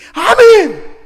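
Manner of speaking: loud, high, emphatic reply; eager amen. Acted. Believer affirming trust in God, loud and eager. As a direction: answer with a wholehearted shout. Acted believer answering God's question.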